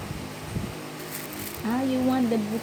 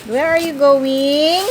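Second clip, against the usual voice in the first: calling out; 6 semitones higher, 6 dB louder than usual. Real, not acted. Calling after wandering child.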